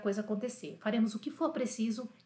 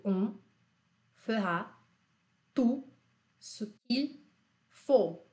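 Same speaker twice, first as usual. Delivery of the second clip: each word apart, with pauses; slow word by word; much slower than usual. Shown as a demonstration. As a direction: spell it out word by word for repeating. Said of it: "Repete comigo". (palavra por palavra, com pausas)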